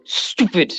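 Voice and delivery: "Stupid" with a long snaky S; extended S. Shown as a demonstration. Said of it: "you just extend the S".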